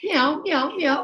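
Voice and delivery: giggly little "Meow, meow" like a cat; playful cat meows. Retold or imitated. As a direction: meow like a kitten while laughing. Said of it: "sounds like a cat".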